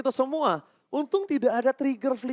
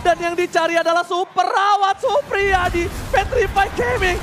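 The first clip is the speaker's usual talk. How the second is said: excited call; 8 semitones higher, 4 dB louder than usual. Real, not acted. Live play-by-play.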